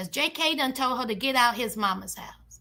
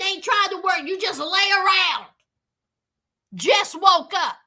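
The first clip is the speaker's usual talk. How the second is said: ordinary delivery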